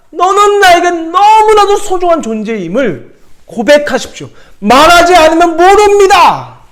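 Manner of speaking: high, loud impassioned sermon; full-force preaching. Acted. Pastor urges the church to speak love, full-force preaching. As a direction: preach it to the back row.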